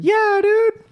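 high squeaky agreement; playful. Real, not acted. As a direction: squeak it out jokingly. Admits being easily scared.

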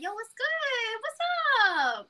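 high, drawn-out holler of hello; loud, exaggerated hey-what's-up; mock street greeting. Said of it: "I don't go up to people in the hospital and be like".

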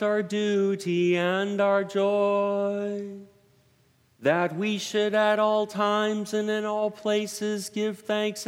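slow, solemn, lifted prayer voice; formal liturgical prayer. Acted. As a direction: proclaim it like a solemn priest. Presider prays the eucharistic preface.

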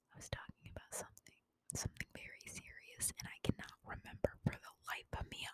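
very soft, quick whisper. Real, not acted. Trying to remember something very serious, whispered. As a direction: whisper under your breath, fast.

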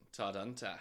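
Todd Hunter in broad Australian vowels; Australian accent. Shown as a demonstration. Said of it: "Say it in an Australian accent".

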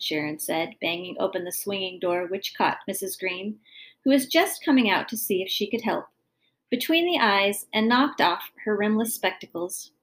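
plain reading voice

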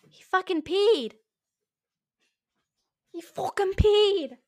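high, drawn-out dismay; real exasperated disbelief. Real, not acted. Owner finding that the dog peed, exasperated disbelief.

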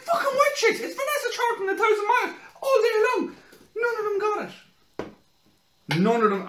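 high, loud laughing rant; frenzied shout. Retold or imitated. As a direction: rant like foaming at the mouth. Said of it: "I'm foaming at the mouth".

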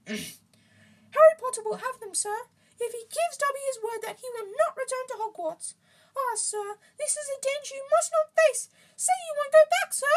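piping creature voice, increasingly insistent; insistent house-elf. A narrator's reading. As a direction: plead urgently as a squeaky little elf.

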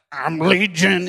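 creepy strained demon voice; freaky possessed man. Acted. Possessed man announcing himself to onlookers, freaky demon voice. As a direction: put on a possessed growl. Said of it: "this dude, it's just like".

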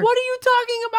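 high, loud disbelief; can't believe what she's saying; incredulous. Real, not acted. Disbelief at crying.

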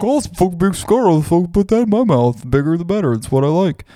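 high, fast mocking dumb-guy voice; dumb smoker. Mocking dumb smokers of big cigars, high and fast. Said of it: "They're like".

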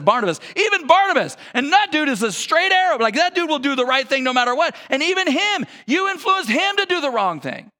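a mild mock scolding rising in disbelief; incredulous rebuke.

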